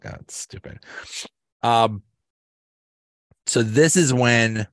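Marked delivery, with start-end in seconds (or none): 0.1-0.8 whispered aside. Retold or imitated. Daryl crying at the party, mock whisper. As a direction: breathe it out under the breath. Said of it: "It's like".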